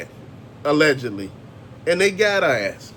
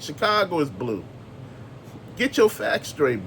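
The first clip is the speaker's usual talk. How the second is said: usual speaking voice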